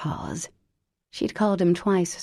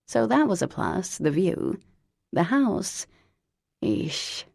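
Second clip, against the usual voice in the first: ordinary delivery, nothing marked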